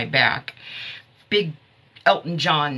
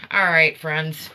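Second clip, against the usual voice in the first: ordinary delivery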